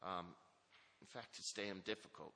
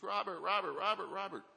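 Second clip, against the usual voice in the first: high, loud calling out of the name; retold scream; 11 semitones higher, 9 dB louder than usual. The director bursting out calling Robert, a high loud yell. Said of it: "came screaming out".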